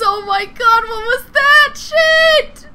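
high, loud disbelieving laugh-cries; shocked yelling. Real, not acted. Shocked after monster attack.

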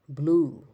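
ব্লু said loudly with a drawn-out oo; ব্লু, slow teacherly oo sound; long "oo" demo. Shown as a demonstration. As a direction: stretch the vowel in ব্লু as a model. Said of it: "ইউ এর উচ্চারণ".

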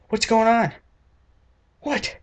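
high disbelieving squeak; mock-shocked high-pitched question; incredulous. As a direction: react in baffled shock. Reacting to Zac Efron movie.

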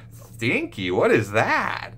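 mock puzzled. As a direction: feign confusion for a child. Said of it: "and I say".

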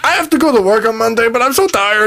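high, far whining complaint; whiny mock voice. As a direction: moan like a tired whiner.

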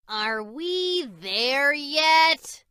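high, slow, drawn-out whine of bored cats; high-pitched whiny cat asking if they've arrived; whining. A narrator's reading. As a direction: stretch it out like a bored kitten. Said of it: "they whined".

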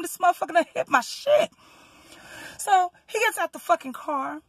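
ordinary delivery

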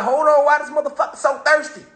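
incredulous. Retold or imitated. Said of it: "it's like".

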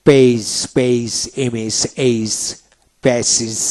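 slow word list, পেজ and এইজ each said apart; teacher's slow model list from পেজ to প্যাসেজ; model pronunciation. Shown as a demonstration. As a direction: sound out পেজ, এইজ, প্যাসেজ slowly, one by one. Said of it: "এইভাবে উচ্চারিত করতে হবে".

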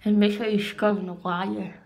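speaking with lip pulled down; talking while brushing braces; brush in mouth. Real, not acted. Seen 2: Blue interdental brush between braces; finger pulls lower lip during speech.

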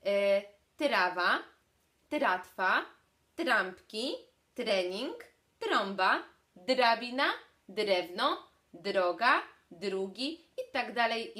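tyratwa, trampki, drabina said with a strong ridge D before the R, loud; speech therapist's word drill. Shown as a demonstration. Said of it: "Zobaczcie, jak to brzmi w wyrazach".

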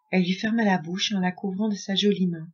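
ordinary delivery

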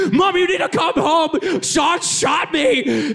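high-pitched, hammed-up panicked cry; mock freak-out. Kid calling mom after being shot, hammed-up freak-out. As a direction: wail it like a kid overdoing an injury. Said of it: "I'm freaking out and losing my mind".

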